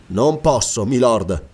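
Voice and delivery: usual speaking voice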